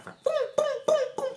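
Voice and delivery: high squeaky pop noises; rapid eye pop sounds. Retold or imitated. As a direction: squeak each pop fast. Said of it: "a bolinha do olho". (sons de pum agudos e rápidos)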